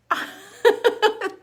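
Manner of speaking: loud, high laugh; burst of laughter. Real, not acted. Amused showing off her sunglasses.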